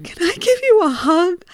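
a high, crying question; tearful request. Retold or imitated. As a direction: ask through tears. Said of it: "with tears pouring down my face".

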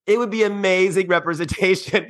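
mock disbelief, laughing; giggling mock quote; laughing through the quote. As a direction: repeat it while cracking up. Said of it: "me and my friends were like".

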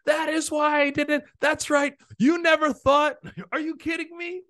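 high, loud ecstatic shout of triumph; ecstatic bowler yelling. Retold or imitated. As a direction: scream it like you just broke the record. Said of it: "I think he says, he's like".

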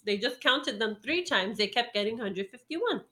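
ordinary talk, nothing marked

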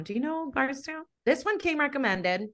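usual speaking voice

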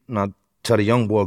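usual speaking voice

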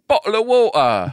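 a hint of posh-English parody; stock English accent. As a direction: do the cliché English "Bottle of water". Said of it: "Everyone goes".

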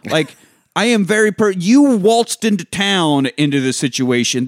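hammed-up outraged comeback; furious retort. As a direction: tear into him, indignant and loud. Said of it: "Turner is fucking pissed".

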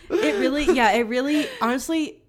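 laughing. Real, not acted. Laughing at friend's answer.

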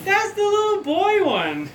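shrill up high in the head voice; squeaky high voice. Acted. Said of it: "and he's like".

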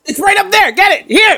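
high, urgent call. Real, not acted. Co-host pointing out a fly to catch, high urgent cry. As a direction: call out high, pushing them to act fast.

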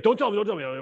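lightly imitated high-pitched urgency; mildly frantic, heading off the answer; urgent interrupting. Street magician stopping a man naming his card, mild high-pitched urgency.

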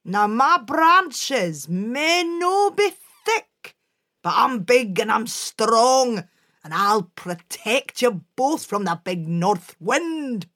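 loud, slow, strong protective voice; big pine tree. Acted. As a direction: sound big and reassuring.

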